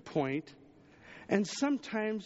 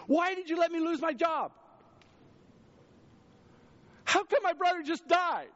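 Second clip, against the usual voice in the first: higher mock complaint, pleading; frustrated complaint to God; 11 semitones higher, 3 dB louder than usual. Believer complaining to God, high and frustrated. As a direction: whine it up at God. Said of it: "That's our first response typically".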